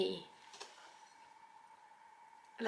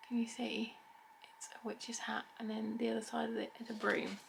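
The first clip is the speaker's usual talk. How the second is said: whisper; 8 dB quieter than usual. Real, not acted. Quiet commentary showing ring.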